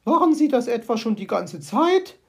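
appalled magpie. Acted. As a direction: recoil in horror. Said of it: "Fragte sie entsetzt".

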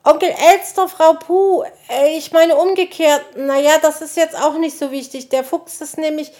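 high, loud, flustered tumble of words; agitated stammering. A narrator's reading. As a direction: blurt out urgent news, tripping over yourself. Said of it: "stammelte der Igel aufgeregt".

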